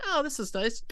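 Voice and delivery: a high, innocent, pleased "oh"; sweetly pleased young self; naive self. Retold or imitated. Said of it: "I was like".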